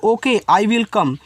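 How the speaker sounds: very slow, carefully pronounced English; slow model sentence. Shown as a demonstration. Hindi teacher models English slowly for students. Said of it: "आप बोल सकते हो".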